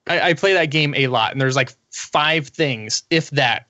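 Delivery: usual speaking voice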